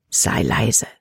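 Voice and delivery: hushed, whispered warning. A narrator's reading. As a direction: whisper it low and secretive. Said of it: "flüsterte er". (geflüsterte Mahnung)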